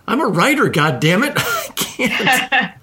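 high, laughing mock outrage at being broke; half-laughing complaint, slowing right down on the last words; laughing mock exasperation. Real, not acted. Joking to the guest about writers' pay, laughing mock exasperation.

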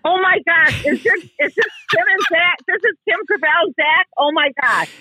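high, starstruck disbelief; real excitement. Real, not acted. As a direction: gush at hearing your idol on the line. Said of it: "I'm so excited".

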